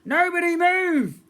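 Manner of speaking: very high, very loud, slow yell of Nobody move; a girl's voice commanding the hotel from the front steps; shouted command. Acted. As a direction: shout it at a frozen room from the dark. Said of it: "a voice shouted".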